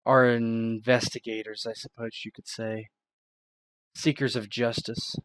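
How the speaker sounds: loud, grave admission; a touch of gravity in her voice; solemn reveal. Acted. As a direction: weigh each word, like a confession. Miranda reveals secret society.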